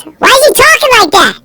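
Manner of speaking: put-on high-pitched, incredulous kid's question; heckling classmate. Classmate questioning his acting in class, high-pitched mock query. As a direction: call it out high, imitating a puzzled classmate. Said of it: "One kid goes".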